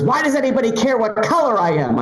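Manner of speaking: high-pitched mock indignant yell; imitated yelling. Friend grievance retold, mock yelling. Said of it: "My friend was yelling".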